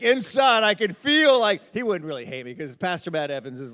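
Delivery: ordinary talk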